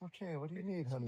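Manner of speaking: soft caring reply to upset partner; tender and calm. Retold or imitated. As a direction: gently ask what she needs. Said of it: "I'm like".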